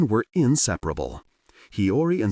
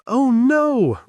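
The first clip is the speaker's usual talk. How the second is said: very high, loud playful shriek; little boy shrieking as he flees; 6 dB louder than usual. A narrator's reading. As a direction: squeal it in mock panic. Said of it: "Ryota shrieked".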